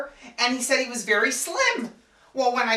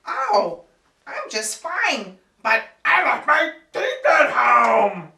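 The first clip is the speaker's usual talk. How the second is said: put-on slow, mumbling old man with no teeth; old date mumbling without his teeth; toothless old man; 6 dB louder, slower than usual. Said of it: "He's just kind of mumbling".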